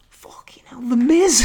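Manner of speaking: hushed, breathless aside; whispered exasperated aside; whispered disbelief. Real, not acted. Disbelieving aside about champion.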